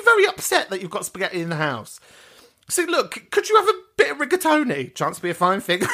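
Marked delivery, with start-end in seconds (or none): none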